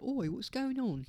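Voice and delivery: mock Cockney copper; bad British accent. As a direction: do a cartoon London bobby. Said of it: "which accent is that".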